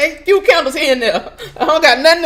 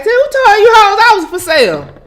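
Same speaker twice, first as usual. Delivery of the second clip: indignant yell; 7 semitones higher, 5 dB louder than usual. Real, not acted. Outraged at being put for sale.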